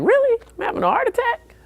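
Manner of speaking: very high, incredulous squeak; mock disbelief. Told by EMTs he's having a heart attack, very high disbelief. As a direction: overplay the shock in a high squeak.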